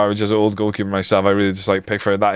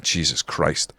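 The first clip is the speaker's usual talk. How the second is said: low, soft oath of disappointment; retold dismay; 8 semitones lower, 7 dB quieter, slower than usual. Fan retelling seeing it was McTominay, hushed dismay. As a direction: let it sink, quiet and low.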